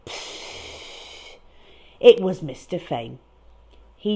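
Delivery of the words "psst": soft leaking hiss; air hiss. A narrator's reading. Balloon hiss imitated for the strange sound. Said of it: "like the sound of a balloon losing air".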